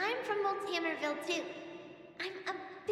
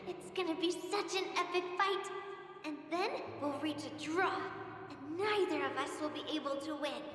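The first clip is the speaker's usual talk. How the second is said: usual speaking voice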